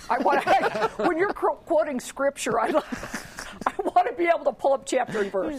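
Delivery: high, amused voice breaking into laughs; laughing. Real, not acted. Panelist teases a colleague over his scripture quote, laughing.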